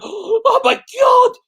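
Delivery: high, slow, drawn-out scandalised oh my God; imitating mourners gasping oh my God; shocked mourners. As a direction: play the shocked crowd at the funeral. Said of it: "people were like".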